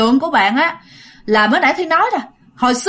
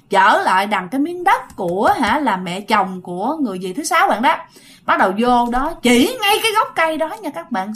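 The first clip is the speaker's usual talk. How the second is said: usual speaking voice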